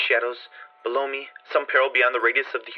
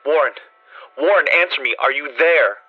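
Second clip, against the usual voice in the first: loud calls into the phone; loud frantic call; desperate calling; 3 semitones higher, 6 dB louder than usual. A narrator's reading. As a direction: shout for him to answer. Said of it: "called, shouted, and screamed".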